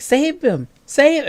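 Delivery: high, pleading cry of a frightened wife; frantic wife. Retold or imitated. Wife pleading as her boss chokes, high and frantic. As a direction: beg for his life in high panic. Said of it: "the wife's like".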